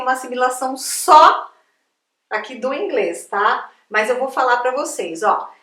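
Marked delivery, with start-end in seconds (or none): none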